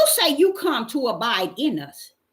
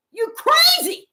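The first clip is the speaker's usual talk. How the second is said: incredulous; 13 semitones higher, 3 dB louder, slower than usual. Acted. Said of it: "Faith looking at him like he crazy".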